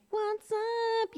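high-pitched, drawn-out party greeting; cocky drunk self. Retold or imitated. Mocking who she thinks she is when drinking, cocky high greeting. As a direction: swoop up high like the life of the party. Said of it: "Who I think I am when I'm drinking".

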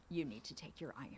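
softly voiced nurse telling the mother; hushed nurse. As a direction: say it under your breath. Nurse voiced quietly in story.

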